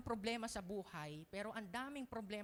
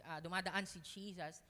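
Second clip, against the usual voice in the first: ordinary talk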